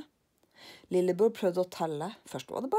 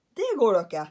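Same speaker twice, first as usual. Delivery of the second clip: put-on louder alarmed boy; protesting lillebror; 3 semitones higher, 9 dB louder than usual. Lillebror protests to Karlsson, louder. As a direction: object loudly.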